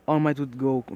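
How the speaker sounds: ordinary delivery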